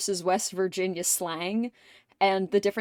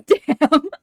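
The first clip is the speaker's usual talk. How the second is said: ordinary talk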